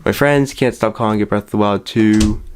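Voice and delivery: ordinary talk, nothing marked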